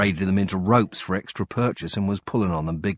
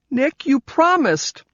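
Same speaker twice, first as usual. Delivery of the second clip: put-on high, slow child's reproach; hurt little girl; 7 dB louder, slower than usual. Kelly reminding Nick of his broken promise, hurt. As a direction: drag out promised like a hurt kid.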